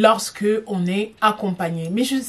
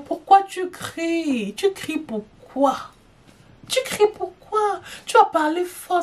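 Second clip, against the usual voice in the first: high, drawn-out teasing reproach; mock scolding; 7 semitones higher than usual. Scolding a friend who shouts at the table, mock exasperated. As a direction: tease her for shouting at the table.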